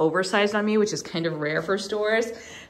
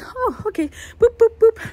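playful imitation; 5 dB louder than usual. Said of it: "He literally looked at my stuff and goes".

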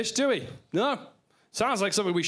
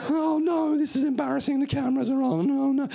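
high, flustered mock-embarrassed voice; embarrassed TV cook, pitched up; Nigella Lawson; 6 semitones higher than usual. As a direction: panic on camera in a raised, hammed-up voice. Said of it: "never see Nigella Lawson halfway through a recipe".

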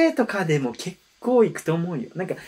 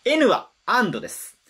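usual speaking voice

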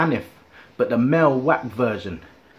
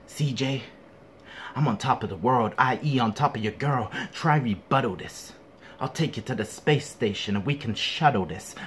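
lower, mocking wrestler voice; wrestler impression; 4 semitones lower, 4 dB quieter than usual. Battle rapper mocking opponent's rap, wrestler impression. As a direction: mimic the rival as a low-voiced wrestler. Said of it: "why do you sound like a wrestler?".

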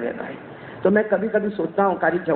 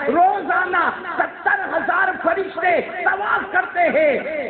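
preaching shout; 8 semitones higher, slower than usual. Acted. Preacher exclaims about angels.

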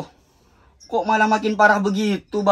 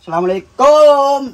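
loud, drawn-out greeting; called-out greeting; 3 semitones higher, 7 dB louder than usual. Acted. Younger brother arriving home, calling out a greeting. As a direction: call it out long and loud.

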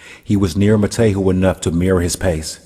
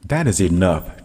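usual speaking voice

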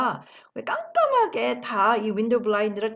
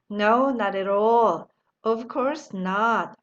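very slow, drawn-out reply; slow model answer; much slower than usual. Shown as a demonstration. Teacher modelling the answer very slowly. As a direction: stretch each word for learners. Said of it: "뭐라고 답을 하셨을까요".

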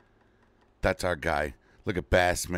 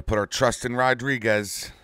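louder and higher, hyped; emphatic louder; 6 semitones higher, 6 dB louder than usual. Real, not acted. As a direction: hype it up to chat. Repeats the line to chat.

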